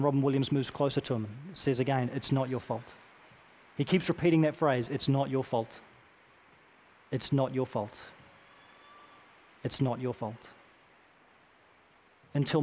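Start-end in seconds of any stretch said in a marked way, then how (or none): 2.1-2.9 softer, lower, intimate and gentle; tender reassurance. Retold or imitated. Moves closer and says again. 4.9-10.5 softer and lower each time, tender; soft repeated reassurance. Retold or imitated. Keeps repeating that phrase.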